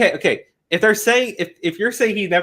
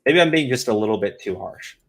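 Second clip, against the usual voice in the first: mock second thoughts; 5 semitones lower than usual. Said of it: "I'm just going".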